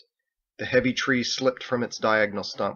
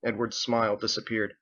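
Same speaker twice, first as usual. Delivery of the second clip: ordinary delivery, nothing marked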